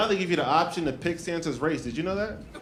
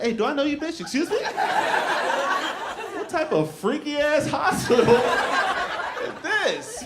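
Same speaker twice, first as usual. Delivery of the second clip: hammed-up outrage at being called daddy; offended; 10 semitones higher, slower than usual. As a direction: push back at a stranger who gets too familiar. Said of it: "I was like".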